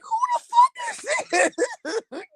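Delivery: high-pitched, incredulous retold reaction; laughing disbelief. Mom retelling her shock at son's voice, high laughing disbelief. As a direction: squeal it through rising laughter.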